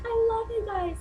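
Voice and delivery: very high-pitched fangirl declaration; reenacted adoring squeal. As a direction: squeal your love at the band. Replays fangirling at band in car.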